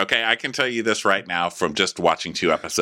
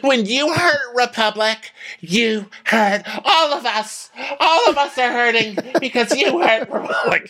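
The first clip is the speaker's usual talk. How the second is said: imitating T J; 3 dB louder than usual. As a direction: wail it like a wounded loyal employee, far higher. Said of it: "T J is the one who's like".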